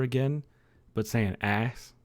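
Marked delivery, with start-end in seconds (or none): 1.4-1.9 put-on "ass" as a southern-accented ice. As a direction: say ice with its vowel flattened into "ass". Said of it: "a woman saying ice over and over again".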